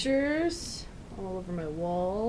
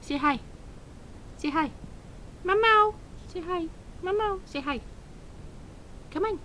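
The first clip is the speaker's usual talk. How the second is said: much higher cooing pet voice; talking to a cat; 11 semitones higher than usual. Real, not acted. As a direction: coax the cat sweetly, high up. Coaxing cat to meow.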